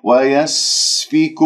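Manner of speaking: ويسفك with hissing س held; tajweed demo; slow recitation demo. Shown as a demonstration. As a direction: let the air flow on the س of ويسفك. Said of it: "السين لاحظتم كيف أنه جرى".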